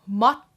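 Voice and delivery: matto with a T pause, said loud; matto, held T. Shown as a demonstration. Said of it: "in the preparation for the T sound".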